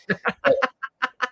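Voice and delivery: a burst of high laughter. Real, not acted. Laughing at the candid camera punchline, high burst of laughter.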